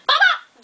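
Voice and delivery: loud, high "Bop, bop"; playful hitting noises; punch sound effects. Retold or imitated. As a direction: imitate quick punches. Jocelyn punches him.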